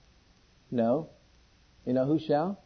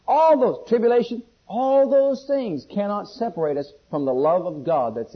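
raised, ringing sermon voice; emphatic preaching; 5 semitones higher, 5 dB louder, slower than usual. Acted. Preacher declaring nothing can separate us, emphatic and raised. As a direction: hammer out the list, stretching key words. Preaches scripture at full force.

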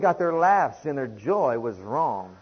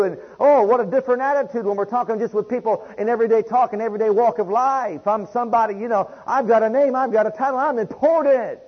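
full-force preaching; 7 semitones higher, 8 dB louder than usual. Acted. Preacher thundering at his congregation about humility, loud and high. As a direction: preach it out over the whole congregation.

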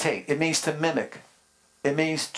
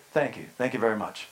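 a hint of [name], low and quick, through a laugh; quick, low [name] mumble, lightly done; mock [name] thank-you; 7 semitones lower, 3 dB quieter, faster than usual. Pastor thanks his wife like [name], lightly mock [name]. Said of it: "give a whatever [name] does".

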